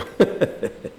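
a quick airy chuckle; soft breathy laugh. Real, not acted. Preacher chuckling to the congregation at his own example, soft laugh. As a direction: laugh under your breath at the story.

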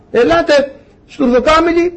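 high-pitched summons, rising at the end; calling out. Acted. Noah summoning animals into the Ark, calling out. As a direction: call the animals over in a high voice.

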